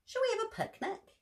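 high, small bear cub voice; Baby Bear voice. Acted. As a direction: squeak it out like a little bear cub.

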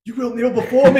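loud, high, laughing villain voice; booming demon lord. Retold or imitated. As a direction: boom it out like a tyrant. General Zod impression, laughing.

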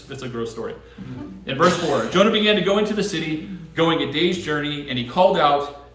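ordinary talk, nothing marked

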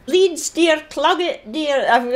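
put-on high old granny puppet voice. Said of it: "can you introduce us to one or two".

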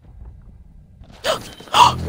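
sharp frightened intake of breath; startled gasp. Real, not acted. Jumpscare in horror game.

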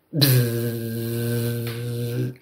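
long low humming buzz. Acted. Dragonfly lifting off with Lila, low buzzing. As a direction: hum like wings beating.

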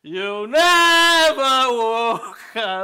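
very slow, loud, over-mouthed; slow and exaggerated. Shown as a demonstration. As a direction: stretch every word out. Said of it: "one more, one more".

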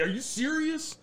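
high-pitched incredulous question; mock disbelief. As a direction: act shocked by the news. Acts out falling for hoax.

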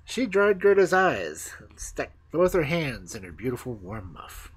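ordinary delivery

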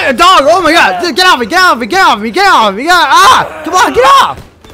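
loud, high panicked yelps at the dog; real frightened shouting; panicked screaming. Real, not acted. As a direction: scream to get the dog off you. Dog attacks player in game.

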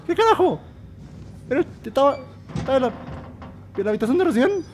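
high, strained, bewildered voice; alarmed disbelief. Real, not acted. Player baffled by the room just seen, high and alarmed. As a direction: protest in high-pitched shock.

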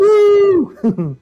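loud high whooping cheer; alarm shout. Real, not acted. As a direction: whoop it out, thrilled. Cheering with co-host.

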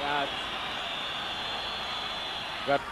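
usual speaking voice

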